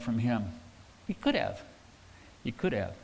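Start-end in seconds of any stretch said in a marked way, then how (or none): 1.1-1.6 a high, wondering "He could have"; the pastor muses aloud, high and wondering; high questioning could have. Shown as a demonstration. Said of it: "repeated answer, first take". 2.4-2.9 a soft, low "He could have"; the pastor quietly answers his own question, softly; quiet, low affirmation. Shown as a demonstration. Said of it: "repeated answer, second take".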